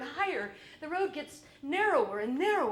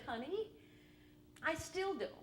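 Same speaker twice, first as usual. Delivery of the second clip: ordinary talk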